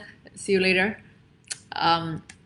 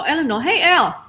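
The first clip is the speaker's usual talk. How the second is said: delighted greeting, higher and louder; calling out; 8 semitones higher, 7 dB louder than usual. Real, not acted. Author calling out happily as her friend joins the stream. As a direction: call out to a friend who just arrived.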